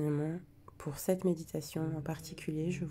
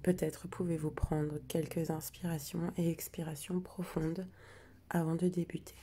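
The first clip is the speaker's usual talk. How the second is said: guided meditation. Acted. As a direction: ease listeners into calm, breath by breath. Guides the breathing and letting go.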